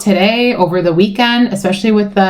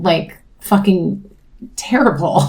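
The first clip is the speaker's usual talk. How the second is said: ordinary talk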